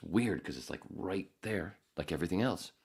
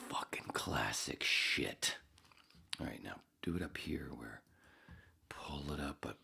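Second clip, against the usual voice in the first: беззвучный шёпот; прошепчи себе под нос; whisper; 8 dB quieter than usual. Real, not acted. Muttering privately beside mic.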